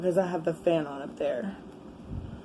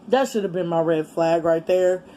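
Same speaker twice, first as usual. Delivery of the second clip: normal talking voice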